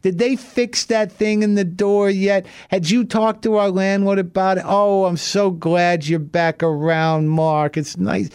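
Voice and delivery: put-on high, slow sweet old lady voice; high, sugary little old Italian lady; sweet old neighbor. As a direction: coo like a doting elderly neighbor. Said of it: "the sweetest little woman".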